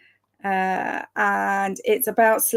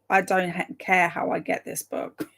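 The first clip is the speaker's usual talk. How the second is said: ordinary delivery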